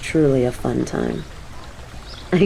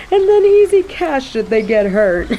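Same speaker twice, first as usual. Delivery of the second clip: mocking laugh; 9 semitones higher than usual.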